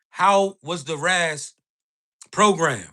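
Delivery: ordinary delivery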